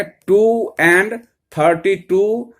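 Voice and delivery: very slow, stretched-out number reading; पढ़कर दिखाना. Shown as a demonstration. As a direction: draw out each word as a teacher demonstrating. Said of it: "तो क्या करेंगे". (बहुत धीरे, हर शब्द खींचकर)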